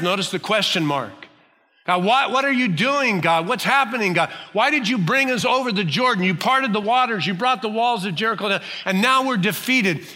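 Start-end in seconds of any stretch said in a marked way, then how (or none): 1.9-10.0 anguished plea. Pretend. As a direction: cry out to God, bewildered and hurt. Joshua's anguished plea voiced.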